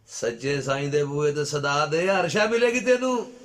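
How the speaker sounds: slow call building to a high, loud cry; loud preacher's appeal, pitch soaring by the end; rising exhortation. Real, not acted. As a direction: climb steadily into full-voiced preaching.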